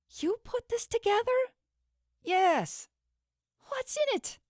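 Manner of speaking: high, shocked questions; shocked disbelief. Acted. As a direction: ask in tearful disbelief.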